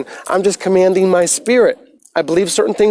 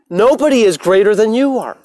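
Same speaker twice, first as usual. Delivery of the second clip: higher, forceful declaration; emphatic proclamation; 4 semitones higher than usual. Real, not acted. Telling viewers nobody is above them, a forceful proclamation. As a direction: proclaim it to the whole crowd.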